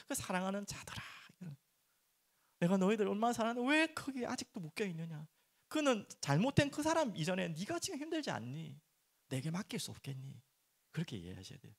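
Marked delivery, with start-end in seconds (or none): 0.1-10.4 higher, gentle voice of God pleading; God speaking tenderly. Acted. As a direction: voice God calling his beloved home. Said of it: "하나님이 보시기 어려운 것".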